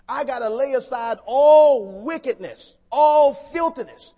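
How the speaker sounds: very loud preaching, heavy on "all"; forceful exhortation. Real, not acted. Preacher answers his own question forcefully.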